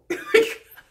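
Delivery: very high laughing squeal; shriek of laughter. Real, not acted. At Crystal's reply, high squealing laugh.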